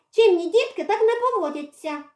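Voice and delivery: high, prim motherly lecture; scolding mother. Acted. Girl lectures the cat on good manners. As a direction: lecture like a strict mum.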